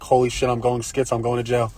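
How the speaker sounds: quiet, worried thought to himself; panicked inner thought. Retold or imitated. Thinking in panic while taunting the bus driver, quiet. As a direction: mutter the panic under your breath. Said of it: "in the back of my mind".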